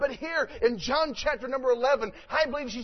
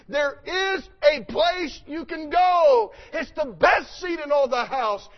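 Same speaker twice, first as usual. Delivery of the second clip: full-force preaching; 3 semitones higher, 4 dB louder than usual. Acted.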